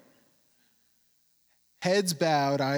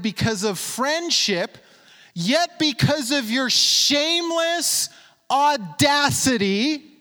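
preaching scripture; 3 semitones higher, 4 dB louder than usual. Acted. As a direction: drive the verse home to the congregation. Preaches Jesus' words with force.

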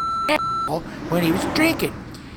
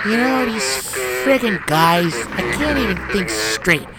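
ordinary delivery

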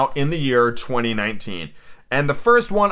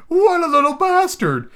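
very high, put-on falsetto spirit; high-voiced ghost; 5 dB louder than usual.

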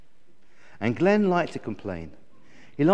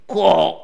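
loud raven squawk; abrupt cartoon bird call; raven caw; 3 semitones higher, 7 dB louder than usual. Raven character calls out.

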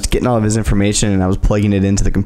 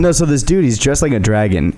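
ordinary talk, nothing marked

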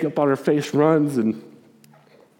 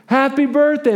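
excited crowd yell of surprise; surprise shout; 11 semitones higher, much slower than usual. Retold or imitated. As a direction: yell it like the whole room jumping out.

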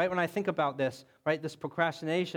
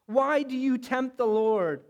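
higher, louder and slow, pleading in exasperation; a leader fed up with his people, drawn out; exasperated rebuke; 6 semitones higher, 4 dB louder, much slower than usual. Acted. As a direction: Moses scolding the complaining people, exasperated. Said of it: "He said to them".

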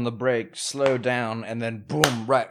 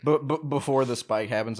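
normal talking voice

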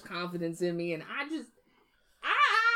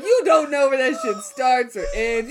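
laughing; 5 semitones higher, 5 dB louder than usual. Real, not acted. Laughing through story.

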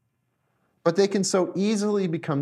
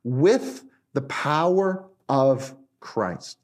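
"with the power of Christ" very slow, in separate weighty pieces; emphatic; 2 semitones lower, much slower than usual. Real, not acted. Preacher telling listeners not to miss this, slow emphasis. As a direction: spell out "with the power of Christ" with pauses between. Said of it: "but don't miss this".

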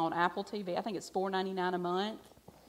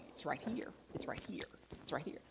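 whisper; 3 semitones lower, 9 dB quieter, faster than usual. Retold or imitated. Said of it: "I'm like".

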